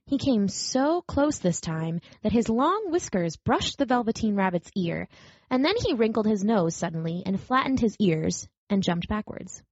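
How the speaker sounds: usual speaking voice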